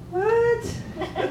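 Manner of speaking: tiny high-pitched incredulous squeak; mock disbelief. Preacher reenacting his reply to God about his wallet, mock disbelief. Reacting to God's costly request.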